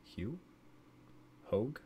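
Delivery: unsure rising tries at Hugh, Hogue; guessing the surname; trying pronunciations. Shown as a demonstration. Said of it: "I'm gonna go with Hogue".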